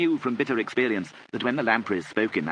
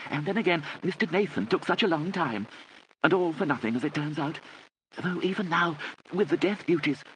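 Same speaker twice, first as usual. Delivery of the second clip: high-pitched rambling aunt's voice; fluttery aunt, rambling; 9 semitones higher than usual. Acted. Aunt Kit explains her late return, fluttery rambling. Narrator voices Lady Catherine.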